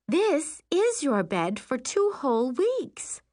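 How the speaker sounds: high, slow singsong mom; coaxing mother. Acted. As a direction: spell it out sweetly for a child. Mother coaxes child.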